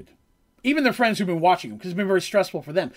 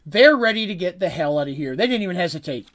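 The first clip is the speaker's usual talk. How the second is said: ordinary talk, nothing marked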